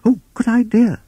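high, loud, excited toad voice. Acted. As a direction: burst out in high-pitched approval. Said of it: "in his excitement".

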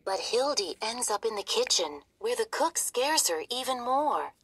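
ordinary delivery

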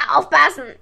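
loud, sudden raven croak; krächzender Rabe. A narrator's reading. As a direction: croak out a warning. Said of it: "krächzte er plötzlich". (krächzender Warnruf des Raben)

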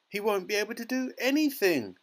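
high, despairing mother; distressed cry. A narrator's reading. Mother despairs for her son's future, crying out. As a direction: cry it out in dismay. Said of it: "cried Missus Teavee".

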